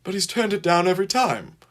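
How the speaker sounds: high, urgent justification. A narrator's reading. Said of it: "urgently he added to that".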